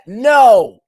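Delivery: loud, high exclaimed no; exasperated refusal. Real, not acted. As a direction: cut them off with a loud no. Cutting off the excuse.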